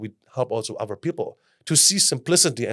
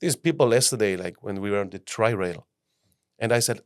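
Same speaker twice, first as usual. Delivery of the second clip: normal talking voice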